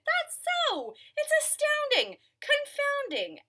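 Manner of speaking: very high, astonished elephant; high-pitched storybook amazement; amazed. Acted. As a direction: marvel aloud in a high swooping voice. Elephant amazed sneeze is gone.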